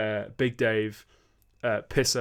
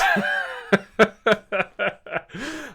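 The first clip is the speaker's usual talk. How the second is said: loud laughing fit; 11 semitones higher, 7 dB louder than usual. Real, not acted. Laughing at own sign-off.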